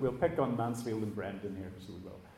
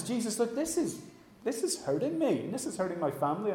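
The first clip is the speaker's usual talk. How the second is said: annoyed; 6 semitones higher, 4 dB quieter than usual. Acted. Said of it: "That gets us really annoyed 'cause we're like".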